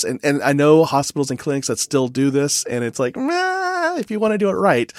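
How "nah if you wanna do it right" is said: long high-pitched "nah", easing down; dismissive doubt. Acted. Commenting on clinics that just hand out sheets, dismissive. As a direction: wave it off with a high, drawn-out noise. Voices reluctance toward careless practice.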